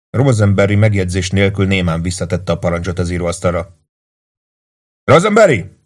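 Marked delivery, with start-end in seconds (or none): 5.0-5.8 furious yell, much higher and louder; angry shout. Acted. Lieutenant yells angrily at his clerk Rosenberry. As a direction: bark the clerk's name in fury. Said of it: "kiáltotta dühösen".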